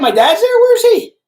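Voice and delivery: very high, loud, eager question; excited search. Real, not acted. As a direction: scan the crowd for your dad, thrilled. Looking for his dad in chat.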